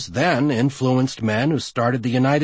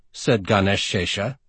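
ordinary delivery

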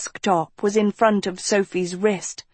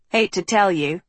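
impatient. A narrator's reading. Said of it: "Tristan said impatiently".

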